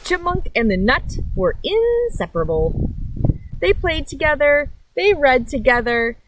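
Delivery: lively picture-book reading with big pauses; reading to children. A narrator's reading. Reading a picture book to kids on a nature channel, lively storytime voice. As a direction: read it like storytime for little ones.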